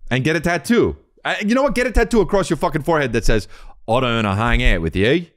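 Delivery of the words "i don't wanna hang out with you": exaggerated Aussie vowels; mock Australian twang; Australian accent. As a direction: put on a broad Australian accent. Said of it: "Since you're Australian".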